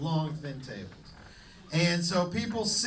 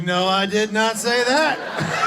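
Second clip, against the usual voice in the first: mock indignant denial; 8 dB louder, slower than usual. Comic denial to the crowd.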